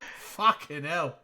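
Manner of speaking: put-on slow Aussie accent sliding into British; attempted Australian accent. Trying Australian for friends, slipping into British accent. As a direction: stretch it out in Cockney-tinged Australian. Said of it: "that's more, like, British, right?".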